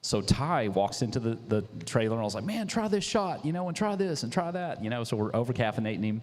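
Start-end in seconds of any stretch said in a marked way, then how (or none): 2.4-4.8 eager offer. Retold or imitated. Owner urging sponsor to try coffee, high and eager. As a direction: push the drinks on him excitedly.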